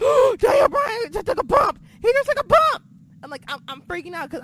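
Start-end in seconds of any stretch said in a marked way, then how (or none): 0.0-2.8 much higher, louder fan yell; excited shout. Retold or imitated. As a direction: yell it at the screen in disbelief.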